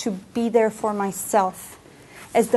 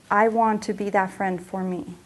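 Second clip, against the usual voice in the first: usual speaking voice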